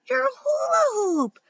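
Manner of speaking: very high, loud shout; excited shout. A narrator's reading. Boy sees the hula hoop twirl over the fence, excited shout. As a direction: yell it in high-pitched amazement. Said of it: "shouted Carlos".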